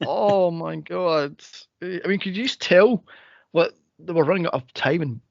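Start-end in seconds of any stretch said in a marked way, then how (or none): 0.0-1.4 slow exasperated groan. Retold or imitated. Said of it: "And I was like".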